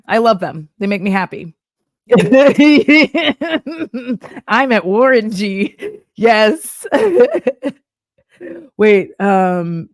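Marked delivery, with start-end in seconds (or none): 2.1-4.4 a big real burst of laughter, pitched up; loud laugh. Real, not acted. Laughs at own joke. 6.9-7.7 high laugh. Real, not acted. Laughs at own joke.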